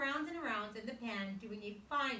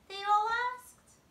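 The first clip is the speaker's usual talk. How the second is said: surprised; 13 semitones higher, 11 dB louder than usual. Acted. Narrator voices group shock.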